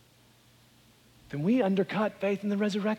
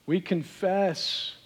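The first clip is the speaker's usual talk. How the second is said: prayer; 4 dB quieter than usual. Acted. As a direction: pray quietly to the Father. Leads closing prayer.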